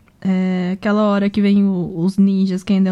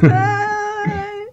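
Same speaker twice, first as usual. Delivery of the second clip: stretched, high yawning sigh; 11 semitones higher than usual. Real, not acted. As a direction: yawn while saying it. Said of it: "também tô bocejando".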